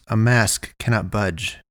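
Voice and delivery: ordinary delivery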